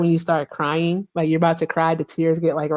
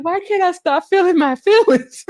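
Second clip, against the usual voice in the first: a much higher, louder dazed wail; mock dazed whine; 12 semitones higher, 4 dB louder than usual. Retelling her high self to friends, a high dazed whine. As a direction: whine it up high like a confused stoner.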